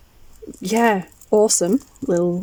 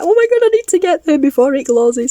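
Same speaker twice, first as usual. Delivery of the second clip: higher and louder, urgent; panicked rush; 8 semitones higher, 5 dB louder than usual. Retold or imitated. Recalling running to the car before the ticket ran out, panicked rush. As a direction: blurt it like you're racing the clock. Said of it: "So I was like".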